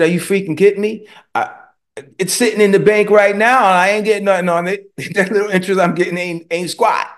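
incredulous outburst. Retold or imitated. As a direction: scoff at the idea, worked up. Retelling his incredulous reply.

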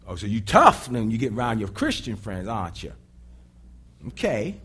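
a hint of a mocking bully; taunting Satan. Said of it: "And he said".

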